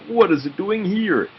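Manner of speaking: put-on high puzzled boy's question. A narrator's reading. Oliver puzzled by where his bag lay, high voice. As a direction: ask it high and baffled.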